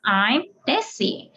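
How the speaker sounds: ordinary delivery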